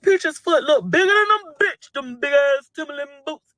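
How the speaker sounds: high, loud teasing jeer; mocking taunt. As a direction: jeer it high to get the whole crowd laughing.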